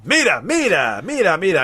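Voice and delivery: high, loud, repeated Spanish call; Spanish, calling mom. Shown as a demonstration. Speaker saying look to their mom, Spanish calling voice. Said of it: "me saying "mira" to my mom".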